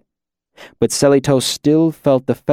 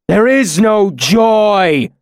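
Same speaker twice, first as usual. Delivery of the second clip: awful shout; 10 semitones higher, 6 dB louder, much slower than usual. Acted. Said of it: "Lanre shouted in an awful voice".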